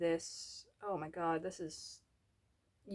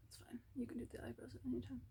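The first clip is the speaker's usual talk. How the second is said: whispering; 4 semitones higher, 10 dB quieter, faster than usual. Real, not acted. Muttered aside about eyebrows.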